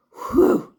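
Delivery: loud high relieved whew. Acted. Baker relieved after nearly falling, whew. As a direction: blow out in relief. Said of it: "Hans sighed".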